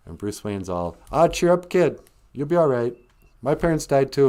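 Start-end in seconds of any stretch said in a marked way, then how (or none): 1.1-4.3 louder, upbeat pep talk; breezy cheering-up. Acted. Bruce Wayne to orphaned boy at his mansion, breezily consoling. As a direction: brush off the grief cheerfully.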